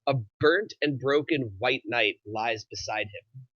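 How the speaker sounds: ordinary talk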